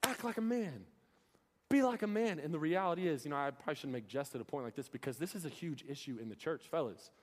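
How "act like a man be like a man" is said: mild high-pitched coach yell; a lightly imitated coach's holler. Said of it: "yelling at me, saying".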